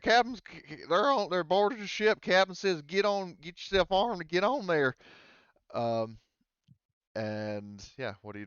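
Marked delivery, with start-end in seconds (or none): 0.0-5.0 high, loud hillbilly pilot in a panic; Mike the hillbilly pilot. Acted. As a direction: yell it up high like a frantic country pilot. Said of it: "hillbilly pilot Mike".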